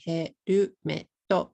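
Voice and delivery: "herumeto" in four separate Japanese syllables; katakana pronunciation. Shown as a demonstration. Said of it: "If you say it in katakana".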